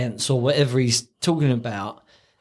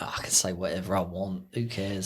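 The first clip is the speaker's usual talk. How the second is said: low, quieter careless shrug; Ricky shrugging off critics, low and offhand; dismissive old man; 7 semitones lower, 8 dB quieter than usual. Retold or imitated. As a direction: toss it off like a grumpy old man. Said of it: "he's just like".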